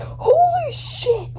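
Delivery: high, drawn-out squeal of disbelief; shrieking excitement. Acted. Fan reacting to the photo, a high slow squeal.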